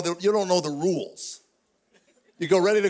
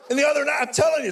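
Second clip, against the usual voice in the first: fast, laughing, raised voice; excited; 7 semitones higher, faster than usual. Real, not acted. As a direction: burst out, laughing. Excited aside, laughing shout.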